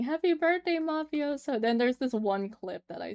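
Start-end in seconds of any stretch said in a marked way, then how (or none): 0.0-1.6 cheery greeting. Retold or imitated. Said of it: "people were saying".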